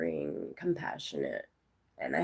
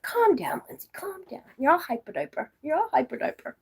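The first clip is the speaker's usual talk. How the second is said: higher-pitched mock mom telling-off; imitating scolding mother; 9 semitones higher than usual. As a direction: mimic an embarrassed mother trying to settle you down. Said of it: "I embarrass my mother ... She'll be like".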